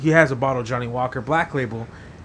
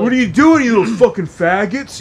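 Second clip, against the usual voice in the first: higher and louder, sneering bully voice; mock bully taunt; 6 semitones higher, 6 dB louder than usual. Older bully jeering at the kids, high sneering voice. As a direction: play the teenage bully, jeering.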